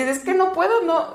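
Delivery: high-pitched retold plea, not fully screamed; pleading cry. Labouring mother answering the woman, high helpless plea. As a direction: imitate a helpless protest in labour. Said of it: "me la pasé gritando".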